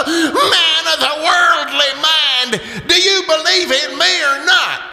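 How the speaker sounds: booming ghostly demand. Acted. Marley's ghost demands Scrooge believe, a high wailing cry. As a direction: wail the question down at him.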